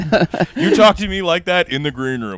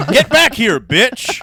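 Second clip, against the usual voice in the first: mock call-back; 8 semitones higher, 5 dB louder, much slower than usual. Jokingly calling back departing girlfriend listener.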